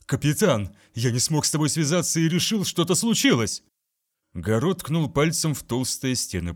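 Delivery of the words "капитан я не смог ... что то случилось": higher, faster alarmed report; worried. A narrator's reading. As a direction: rush in, anxious and urgent. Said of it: "выражало крайнее беспокойство".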